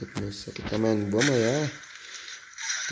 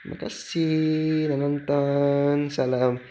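slow outro sign-off; 4 semitones higher, much slower than usual. Acted.

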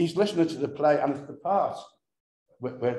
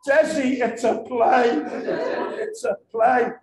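high, loud, laughing through the words; can barely get it out for laughing; laughing hard; 11 semitones higher, 7 dB louder, slower than usual. Real, not acted. Telling Desi it's only a radio play, laughing hard. Laughing retelling Desi's outburst.

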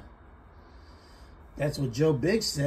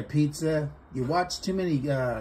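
normal talking voice

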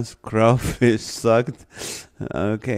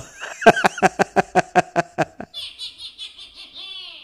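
high burst of laughter. Real, not acted. Big laugh at a comment.

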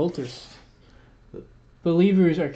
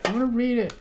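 higher but quieter, sing-song plea for "wanna"; softer whine; 7 semitones higher, faster than usual. Real, not acted. Friend repeats request, softer pleading sing-song. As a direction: ask again, softer and pleading. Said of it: "Let me read it".

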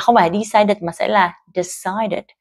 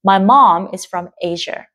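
"mom" and "Asia" stressed, slow. Shown as a demonstration. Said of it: "từ mom và từ Asia là hai từ được nhấn". (nhấn vào "mom" và "Asia")

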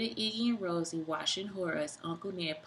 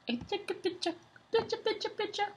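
sing-song; 14 semitones higher than usual. Acted.

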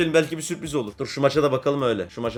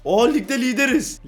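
surprised; 9 semitones higher, 4 dB louder than usual. Real, not acted. Liderliği görünce şaşırıyor.

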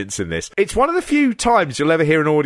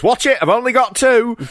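higher-pitched comic wrestler complaint; hammed-up warning, pitched up; mock protest; 9 semitones higher, 3 dB louder than usual. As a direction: imitate a witless relative cracking an old joke. Voicing wrestler whose leg is grabbed.

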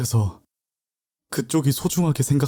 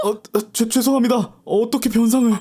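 flustered apology; 11 semitones higher than usual. Acted. Boy broke her flower pot.

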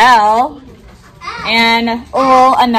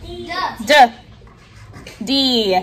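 usual speaking voice

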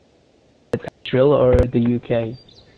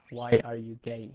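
quiet translation; 2 semitones lower, 14 dB quieter than usual. Shown as a demonstration.

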